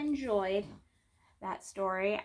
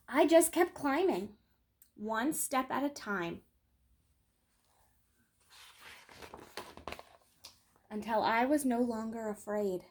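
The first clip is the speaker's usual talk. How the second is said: gentle picture-book read-aloud, lingering on key moments; storytelling. Acted. Reading a children's book to her viewers, gentle storytelling. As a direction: tell it like a bedtime story for kids.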